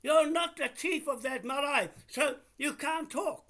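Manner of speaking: real heated dismissal; angry challenge. Real, not acted. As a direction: shout them down indignantly. Challenging the caller's standing in quarrel.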